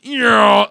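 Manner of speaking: loud mock plane-dive noise; imitated plane noise. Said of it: "the plane goes".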